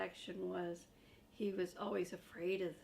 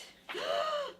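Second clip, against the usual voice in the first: high, shocked puppet gasp; big gasp; 7 dB louder than usual. Acted. Grover gasps at the turned page, high gasp.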